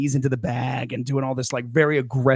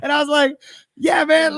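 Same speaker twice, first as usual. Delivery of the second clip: laughing through it; 14 semitones higher than usual. Real, not acted. Laughing while retelling.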